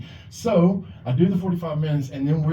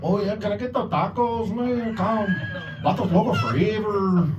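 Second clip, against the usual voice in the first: hammed-up Mexican accent, pitched up; ordering tacos in a put-on Mexican accent; playful mock Mexican accent at a restaurant; 8 semitones higher than usual. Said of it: "use a fucking Mexican accent".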